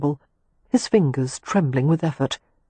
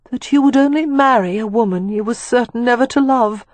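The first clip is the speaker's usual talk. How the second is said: Catherine, hurt; 7 semitones higher, 4 dB louder than usual. A narrator's reading. To Leo, whom she overheard, a high hurt accusation.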